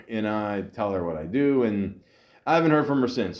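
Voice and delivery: usual speaking voice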